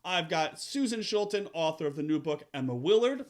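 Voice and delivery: ordinary talk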